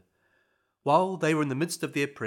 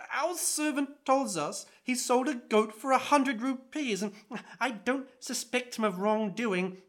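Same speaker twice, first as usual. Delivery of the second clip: higher, amused husband's voice; a higher-pitched landowner explaining; Zamandar's voice; 8 semitones higher than usual. A narrator's reading. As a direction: play the landowner, pitched up and wry.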